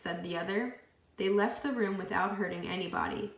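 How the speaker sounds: usual speaking voice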